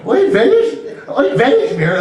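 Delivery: high Irish brogue on Yiddish words; Irish accent. Acted. Mimicking the Irish caller's Yiddish, Irish accent. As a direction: repeat it in an exaggerated Irish accent. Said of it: "like, with that accent".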